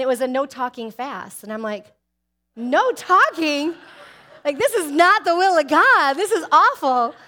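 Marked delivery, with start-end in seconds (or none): 2.6-3.7 slow, high, loud outraged question; mock disbelief. Acting out her shocked reaction. 4.4-7.1 high, loud comic protest; mock outrage. Acting out her protest at fast.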